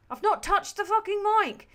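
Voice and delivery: loud protest of innocence; exasperated outburst. Real, not acted.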